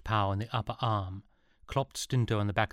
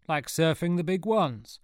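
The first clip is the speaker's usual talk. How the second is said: high, louder surfer-dude voice; Beach Boy act; 8 semitones higher, 4 dB louder than usual. A narrator's reading. Stinto showing off to Sean, surfer-dude voice. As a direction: ham up the laid-back surfer. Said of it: "began his Beach Boy act again".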